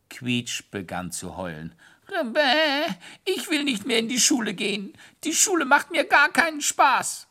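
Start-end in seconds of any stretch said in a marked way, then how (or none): 2.1-7.3 very high, far squeaky voice, whining; a tiny hare wailing about school, high and loud; whiny little hare. Acted.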